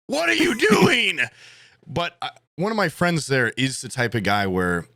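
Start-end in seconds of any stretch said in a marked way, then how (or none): 0.1-1.2 high, slow, loud challenge; mock-angry bar challenge; mock confrontation. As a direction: draw it out like squaring up at the bar. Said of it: "normally I'd be a little more like".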